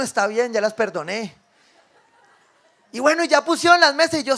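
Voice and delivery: ordinary delivery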